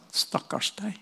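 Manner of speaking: softly retold pity; hushed, understated sympathy; pitying mother. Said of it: "Da gråt hun, og så sa hun".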